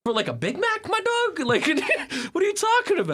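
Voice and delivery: very high, comic incredulous protest; laughing disbelief. Retold or imitated. Tourist argues over a Big Mac's price, laughing in disbelief.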